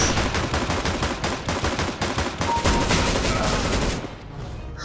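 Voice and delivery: high wheezing laughter; breathless laugh. Real, not acted. Laughing after getting killed.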